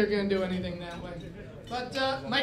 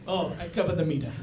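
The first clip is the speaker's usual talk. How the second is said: put-on Greek cabbie accent; 7 semitones lower than usual. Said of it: "the guy looks at me and he goes".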